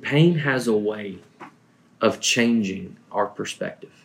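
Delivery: gentle, tender reassurance; soft and tender. Retold or imitated. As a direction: say it softly, like comforting someone hurting. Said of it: "in a very soft and tender tone".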